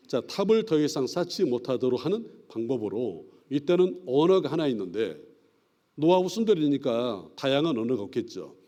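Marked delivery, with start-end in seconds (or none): none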